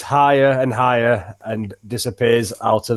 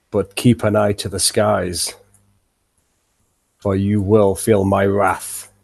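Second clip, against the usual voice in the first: dragon voice; 4 semitones lower than usual. Acted. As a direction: growl it out as a looming dragon. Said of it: "now you've got the dragon voice on".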